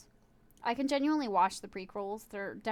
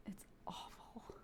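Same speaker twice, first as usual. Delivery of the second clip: slow whispered it's awful; whispered verdict; 21 dB quieter, slower than usual. Real, not acted. Streamer judging a bad line delivery, whispered. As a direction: breathe it out in disgust.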